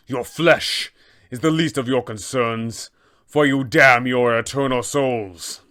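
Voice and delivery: loud, solemn and condemning; abbot's battle lines. Acted. Abbot condemns the party mid-combat, loud and solemn. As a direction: preach judgment down at the party from above. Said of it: "he will say such lines".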